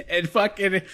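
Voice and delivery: bursting out laughing; loud high laugh at his own story; cracking up mid-sentence; real laughter. Real, not acted.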